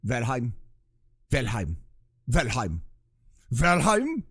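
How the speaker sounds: hammed-up German accent, calling out a name; movie German accent. Streamer calling out the game's name, mock German accent. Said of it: "movie version of a, of a German accent".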